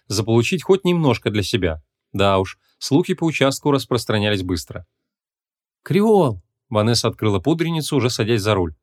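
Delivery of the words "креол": sharp high call of a name. A narrator's reading. Vanessa summons the mage in her compact, calling out.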